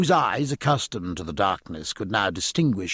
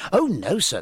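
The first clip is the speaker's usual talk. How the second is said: earnest, higher-pitched protest; young midshipman's earnest objection; earnest cry; 9 semitones higher, 3 dB louder than usual. A narrator's reading. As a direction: correct him eagerly and sincerely. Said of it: "they cried earnestly".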